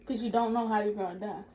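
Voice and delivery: ordinary talk, nothing marked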